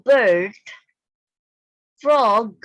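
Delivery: bird and frog called out loud and crisp; loud model words. Shown as a demonstration. Teacher calls out bird and frog loudly for pupils to repeat. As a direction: belt each word out for the class to echo. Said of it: "Listen and repeat after your teacher".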